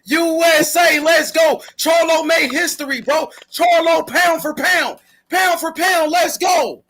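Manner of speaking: high, drawn-out hype calls; hype shouting. Real, not acted. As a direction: chant it out high for your fighter, stretching the words. Host hypes up the winning fighter.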